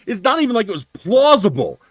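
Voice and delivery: high, loud disbelief; retold indignant rant. Driver scorning the client's story, pissed off. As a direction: throw it back as an outraged objection. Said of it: "I was pissed off to no end".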